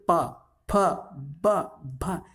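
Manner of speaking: पा, फा, बा, भा popped singly off the lips, with pauses; labial articulation. Shown as a demonstration. Teacher shows learners the lips being used, separate labial पा, फा, बा, भा. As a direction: press the lips for each of पा, फा, बा, भा. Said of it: "ये देखो यहाँ ओष्ठ है".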